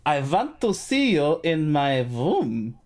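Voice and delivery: slow, drawn-out invitation; slow quoted line. Retold or imitated. Podcaster quotes a favorite comedy line, slowly. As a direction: stretch each word out slowly. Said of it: "the other one that kills me".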